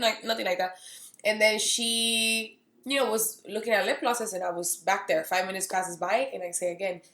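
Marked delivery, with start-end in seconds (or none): none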